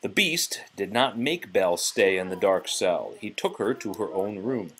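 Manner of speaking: ordinary delivery, nothing marked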